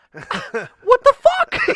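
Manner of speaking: very high, loud, drawn-out disbelief; re-enacted indignant outburst; outraged shriek. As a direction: shout it in shocked protest. Retelling outraged reaction to being mocked.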